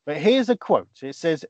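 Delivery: usual speaking voice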